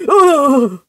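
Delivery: disgusted ugh. Acted. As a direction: let out a big exasperated "ugh". Disgust at end of character's rant.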